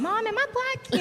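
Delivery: put-on high, loud childlike call; mock call to mom. A streamer pretending to ask her mom, high and loud.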